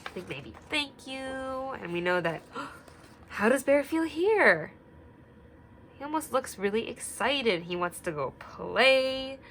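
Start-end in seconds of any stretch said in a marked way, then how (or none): 2.5-4.7 gasp, then a higher, louder question; gasp of surprise. Acted. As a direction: act amazed for the children. Gasp at new page.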